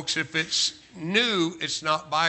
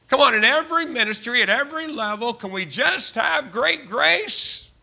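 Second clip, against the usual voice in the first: higher, rousing appeal to the whole church; emphatic plea; 6 semitones higher, 4 dB louder than usual. Real, not acted. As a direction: plead it high, stretching the final words. Pleading with all ministries for grace.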